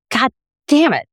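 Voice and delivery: sudden exasperated curse, pitched high; mock angry outburst. Tasting the porchetta, a high mock-angry curse. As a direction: blurt it out in mock frustration. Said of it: "I got very angry because I was like".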